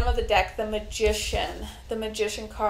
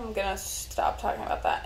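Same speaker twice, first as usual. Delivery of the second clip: ordinary delivery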